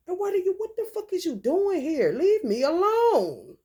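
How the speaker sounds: high and loud, shout him away; mock angry shout. Confronting ex at her house.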